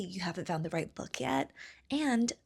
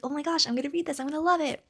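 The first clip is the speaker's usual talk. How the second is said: higher, eager and getting louder; excited retold self; 9 semitones higher, 4 dB louder, faster than usual. Teenage [name] at the library, eager and higher. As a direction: bubble over with teenage excitement about a new book. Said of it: "I'm like".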